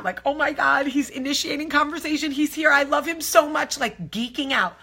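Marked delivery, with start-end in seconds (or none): 0.3-3.8 put-on high, giddy little-girl squeal of thought; freaking out inside. Wife's inner little girl thrilled husband is talking to her, freaking out. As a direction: geek out, pitched up and rushing. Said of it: "was freaking out".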